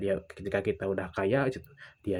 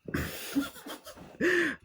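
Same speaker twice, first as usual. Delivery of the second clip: high burst of laughter; amused laugh. Real, not acted. Laughs while telling the story. (tawa geli bernada tinggi)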